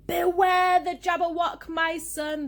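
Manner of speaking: loud, high theatrical warning; urgent warning. A narrator's reading. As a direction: call out a dire warning to a son. Father's warning acted out in poem.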